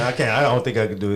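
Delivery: ordinary talk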